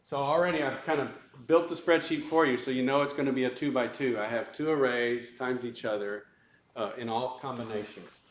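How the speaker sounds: usual speaking voice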